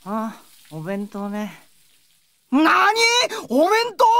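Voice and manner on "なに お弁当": high, loud startled double-take; jolted into yelling at the news; shocked disbelief. Acted. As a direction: blurt it out in disbelief. Brother shocked she is cooking.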